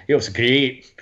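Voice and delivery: slow, drawn-out Scottish lilt; Scottish accent. Shown as a demonstration. Showing friends the hard part of Scottish, slow accent. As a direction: stretch it out in Scottish. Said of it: "it's Scottish there".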